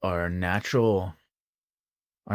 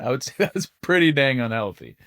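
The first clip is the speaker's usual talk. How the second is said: laughing; 5 semitones higher, 4 dB louder than usual. Real, not acted. Laughs at own exaggeration.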